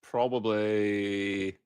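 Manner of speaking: very slow, drawn-out word; reluctant. Real, not acted. Co-host forced to pick worst accent, reluctant. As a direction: stretch it out softly while stalling. Reluctantly gives an offensive answer.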